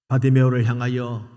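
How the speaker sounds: ordinary talk, nothing marked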